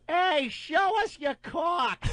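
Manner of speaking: put-on high, slow yelling girl; drunk woman catcall. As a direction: holler it like a tipsy bride-to-be. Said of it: "one of 'em goes".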